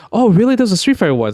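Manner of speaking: put-on light up like a kid who just found the tape; surprised excitement. Kid in a shop spotting a bootleg, high and excited. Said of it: "And I was like".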